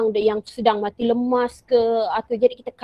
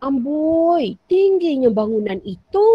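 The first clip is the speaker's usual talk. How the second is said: kagum; 4 semitones higher, 3 dB louder, slower than usual. Shown as a demonstration. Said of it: "hairan atau kagum".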